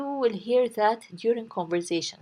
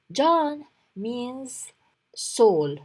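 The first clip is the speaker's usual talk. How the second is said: ordinary talk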